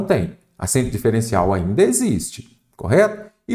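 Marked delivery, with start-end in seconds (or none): none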